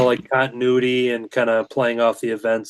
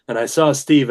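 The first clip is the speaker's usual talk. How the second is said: normal talking voice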